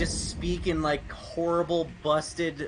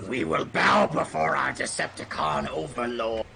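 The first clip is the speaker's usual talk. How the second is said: evil overlord voice; 5 semitones lower than usual. Pretend. Villain decree.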